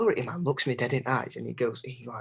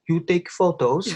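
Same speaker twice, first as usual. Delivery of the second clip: security man; 5 semitones higher, 8 dB louder, slower than usual. Retold or imitated. Security man signals to phone girl.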